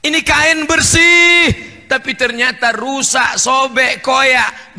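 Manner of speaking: high, loud, drawn-out proclamation; seruan lantang. Real, not acted. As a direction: proclaim it slowly at full voice. Preacher proclaims the example loudly. (seruan lantang bernada tinggi)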